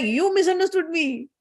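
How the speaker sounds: high-pitched mock protest; angry Brahmaji. Brahma answering back in the quarrel, angry. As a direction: retort like an aggrieved husband. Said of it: "Brahmaji is also angry".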